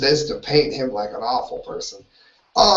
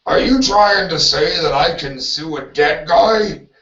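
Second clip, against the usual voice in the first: a lightly voiced, slightly louder gruff wrestler-politician; Jesse Ventura impersonation; 2 semitones higher, 4 dB louder than usual. Host mimics the sued celebrity answering the lawsuit headline, light mock impression. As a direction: play the outraged big guy in a mock rebuttal. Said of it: "That's my best Jesse Ventura impersonation".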